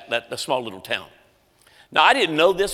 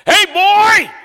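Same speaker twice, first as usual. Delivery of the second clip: much higher, much louder bellow; old man yelling across a packed room; 13 dB louder than usual. Retold or imitated. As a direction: call out over a noisy crowd. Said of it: "he yells at the top of his lungs".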